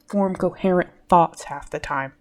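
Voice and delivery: normal talking voice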